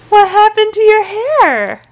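put-on drawn-out squeaky baby voice; high baby talk. Mom teasing baby.

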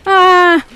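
loud shocked yelp; startled yell. Real, not acted. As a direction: cry out after a scare. Jump scare in a game.

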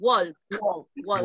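"Walk" closed with an audible K; K at the end. Shown as a demonstration. Teacher correcting students, "Walk" with final K. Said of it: "Con K al final".

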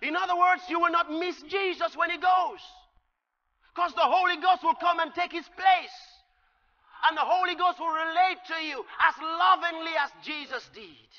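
loud, high preaching build; full-force sermon climax; fiery preaching. Acted. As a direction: preach it out with rising fire. Preaching continues.

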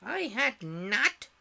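high, loud, drawn-out indignant mouse; sharp angry cry. A narrator's reading. Mouse contradicting Alice, very angry cry. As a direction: cry it out angrily, stretching each word. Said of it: "cried the mouse sharply and very angrily".

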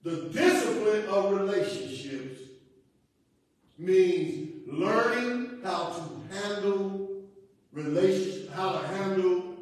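loud, drawn-out key words; slow, emphatic teaching from the pulpit; forceful preaching. Real, not acted. Pastor defining discipline of relationships for the congregation, forceful preaching.

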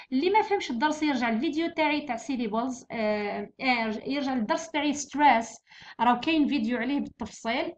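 normal talking voice

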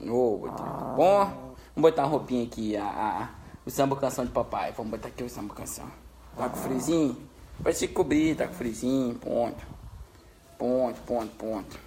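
low, soft murmur to a pet; hushed, gentle coaxing; tucking in tenderly. Real, not acted. Settles pet down to sleep.

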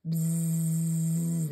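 long steady buzzing; bee buzz. Shown as a demonstration. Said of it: "bees buzz".

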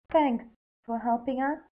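lightly voiced grateful girl; a hint of a young girl saying thanks; girl character voice. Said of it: "she said".

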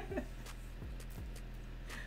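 high, soft wheezy laugh. Real, not acted. Host amused at his own past, soft high laugh. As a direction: trail off into quiet high laughter.

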